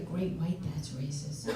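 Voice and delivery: low, hushed imitation of a whisper; retold whisper. Daughter in mother's ear about sharks, whispered. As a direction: drop it to a confidential near-whisper. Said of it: "She whispered in my ear".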